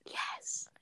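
whispered breathy yes; breathed whisper. Acted. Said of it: "you breathed".

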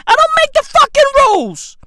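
fast, high-pitched yelling; shrieked comic protest; exasperated disclaimer. Acted. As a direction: squeal it in a hurry, fed up. Comic rant, disclaiming blame loudly.

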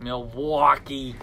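"Milwaukee" slow and loud with a stretched "aw"; mocking the aw. Teasing his co-host's "Milwaukee" with a slow, loud "aw". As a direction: drag out the "waw" in "Milwaukee". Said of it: "It's the aw".

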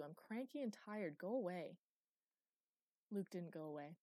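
ordinary delivery, nothing marked

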